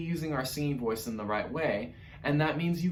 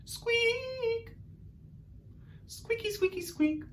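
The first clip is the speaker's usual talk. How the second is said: very high, soft squeaks; Artie squeaks; 8 dB quieter, much slower than usual. Shown as a demonstration. Teacher demos Artie's squeaks for the class. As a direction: squeak like a tiny mouse. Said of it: "our best Artie squeaks".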